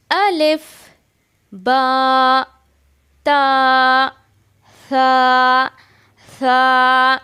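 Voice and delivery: slow loud clear letter names; alphabet recitation. Shown as a demonstration. Teacher reciting Arabic letters for students to repeat. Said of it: "जिस तरीके से मैं पढ़ रही हूँ".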